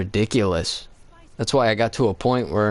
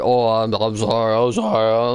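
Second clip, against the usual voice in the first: mimicking apology. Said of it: "So you're mimicking his voice?".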